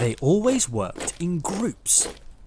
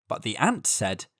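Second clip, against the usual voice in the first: usual speaking voice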